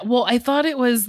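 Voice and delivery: ordinary talk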